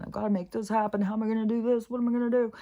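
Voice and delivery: a hammed-up frantic voice, pitched high; mock stressed panic. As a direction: send up her own frantic self. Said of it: "where you're just all stressed".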